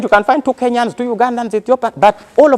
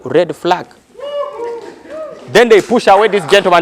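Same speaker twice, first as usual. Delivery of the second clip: ordinary talk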